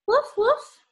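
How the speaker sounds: high yappy puppy woof; puppy bark. Acted. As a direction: bark like a small happy dog. Dog barks in the story.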